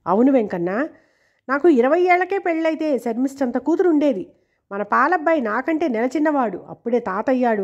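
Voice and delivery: plain reading voice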